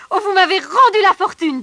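loud, high burst of gratitude; overjoyed at his new riches; joyful thanks. Acted. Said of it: "dit le soldat". (remerciement joyeux, fort et aigu)

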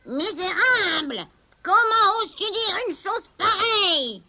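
high, slow outraged cry; furious witch. Acted. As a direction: spit out her indignation. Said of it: "s'écria la sorcière".

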